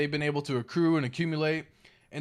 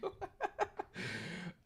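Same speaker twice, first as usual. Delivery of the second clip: silent wheezing laugh; breathless laugh; 14 dB quieter than usual. Real, not acted. Streamer laughs at people losing everything, breathless wheezing laugh. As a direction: laugh with no voice at all.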